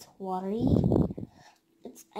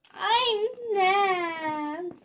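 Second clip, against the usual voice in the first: mock crying; 7 semitones higher, 3 dB louder than usual. Got coal, crying out.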